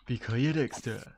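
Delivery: put-on loud Japanese accent on the name. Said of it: "Why you have to put that in a Japanese accent".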